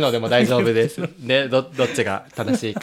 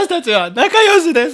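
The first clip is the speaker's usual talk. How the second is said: much higher, louder, slow robotic voice; forced declaration; 13 semitones higher, 6 dB louder, slower than usual. Pretend. Co-host forced to declare friendship, high robotic recitation. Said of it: "わかっただろ".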